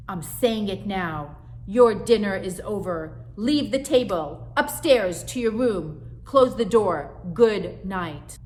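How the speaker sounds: loud, measured final orders; stern mother. Acted. Mother dismissing daughter from dinner, loud and measured. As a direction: lay down each order flatly. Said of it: "mother ends dinner".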